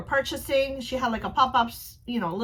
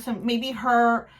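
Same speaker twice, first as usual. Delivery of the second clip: ordinary delivery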